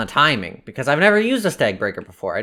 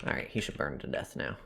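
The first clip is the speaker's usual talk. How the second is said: whispering; 9 semitones lower, 12 dB quieter than usual. Real, not acted. Quiet remark in game.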